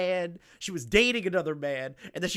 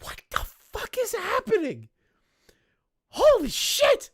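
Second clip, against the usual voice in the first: very high squeal of disbelief; stunned outburst. Real, not acted. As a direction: squeal it in sheer amazement. Shocked reaction to the story.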